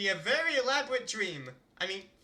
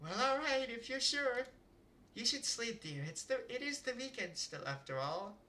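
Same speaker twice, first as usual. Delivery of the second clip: lightly voiced mom; 5 semitones higher, 7 dB quieter than usual.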